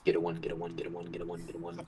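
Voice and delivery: very soft, low rapid chant; muttered jinx. Pretend. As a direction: chant it under your breath, fading away. Player wishes a bad roll.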